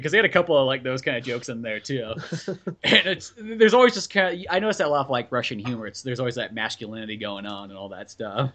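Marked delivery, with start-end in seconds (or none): none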